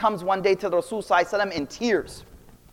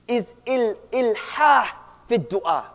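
ordinary talk, nothing marked

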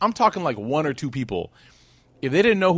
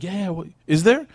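alarmed curiosity; 6 semitones higher than usual. Retold or imitated. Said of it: "That's their reaction".

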